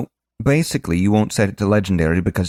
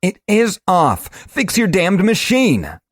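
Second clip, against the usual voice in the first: much higher, louder and slow angry shout; losing his temper; 4 dB louder, slower than usual. Acted. Korash yelling back at Globin, angry and slow. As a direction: spit each word out, temper gone. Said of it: "Korash was now losing his temper".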